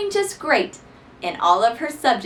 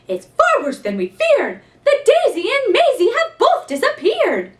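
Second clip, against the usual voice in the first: high, louder, half-laughing panic; cried out; 12 semitones higher, 5 dB louder than usual. Acted. Missus Sneecher cries out to the principal in high panic. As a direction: wail it like a flustered teacher. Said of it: "cried Missus Sneecher".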